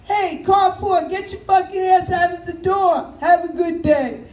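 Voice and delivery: loud, high ranting conductor over the PA; old subway conductor yell. Retold or imitated. Conductor yelling at riders through the train speaker, loud and high. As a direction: bark at the whole car like a fed-up conductor. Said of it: "He'd say".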